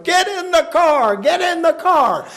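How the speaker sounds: high, urgent acted holler; urgent father. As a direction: holler like a father rushing the family out. Father urges family as siren sounds.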